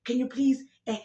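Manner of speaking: normal talking voice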